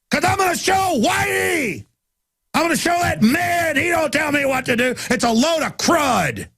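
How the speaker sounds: high-pitched, mocking caricature of a liberal woman, yelling. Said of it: "And you're like, yeah".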